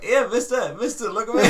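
put-on high, loud eager child; little kid voice. A kid in the park calling to him, high and eager. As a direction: chirp it like an attention-seeking kid.

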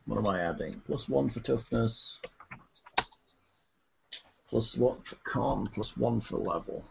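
muttering. Real, not acted. Counting under breath.

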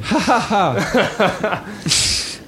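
high, mocking group cackle; acted jeering laugh; gang laughing. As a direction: laugh in the victim's face. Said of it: "And they all start laughing".

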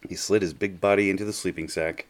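plain reading voice

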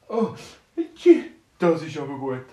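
a delighted little dwarf gasping with joy; squeaky dwarf. Acted. Dwarf voiced in bedtime story.

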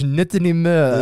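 put-on Not anymore in loud high French accent; bad French accent. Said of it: "in a bad French accent".

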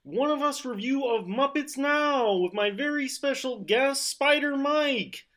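high-pitched frog puppet host; Kermit the Frog. Acted. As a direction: introduce the show in a high froggy puppet voice. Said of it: "Kermit the Frog impression".

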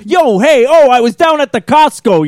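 high, loud hollered impression of a buddy; hammed-up hollering regular guy; imitating Eric. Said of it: "Eric was like".

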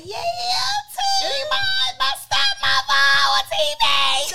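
loud, high cheering toast; excited toast. Retold or imitated. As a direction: cheer it out like a proud toast at dinner. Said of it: "Kashana was toasting".

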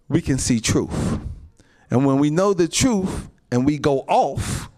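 loud, driving sermon voice; rising preacher's fervor; full-force preaching. Acted. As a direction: preach it hard to the saints.

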